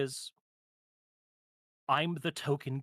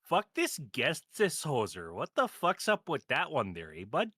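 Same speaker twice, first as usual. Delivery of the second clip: a lighter hammed-up Canadian hoser accent; Canadian accent again; 4 dB louder than usual. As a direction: slip back into a mock Canadian grumble. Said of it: "do the Canadian accent".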